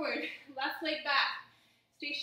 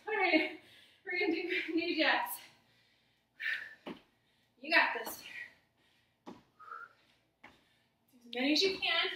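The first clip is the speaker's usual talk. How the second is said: calling out; 3 semitones higher, 4 dB louder than usual. Real, not acted. Coach calling the next move.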